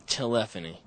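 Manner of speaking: telephony said as tuh-LEFF-uh-nee; British-like pronunciation of telephony. Shown as a demonstration. Host agrees on the British-sounding one, telephony as tuh-LEFF-uh-nee. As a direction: stress LEF in telephony, almost British. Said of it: "It's almost, uh, British".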